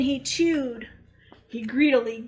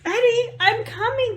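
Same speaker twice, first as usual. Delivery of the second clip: high, loud calling out; 10 semitones higher, 4 dB louder than usual. Acted. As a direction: call across the woods. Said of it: "called Mom".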